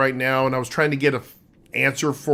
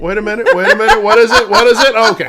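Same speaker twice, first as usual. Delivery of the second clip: loud, higher, repeated burst; hammed-up agitated questioning; mock alarm; 6 semitones higher, 8 dB louder, faster than usual. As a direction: play it up as sudden urgent confusion. Acts out his automatic response.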